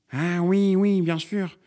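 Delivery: high, drawn-out neighbour voice; dawning understanding. A narrator's reading. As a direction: let the penny drop.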